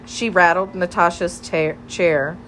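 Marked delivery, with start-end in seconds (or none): none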